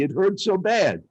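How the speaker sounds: ordinary talk, nothing marked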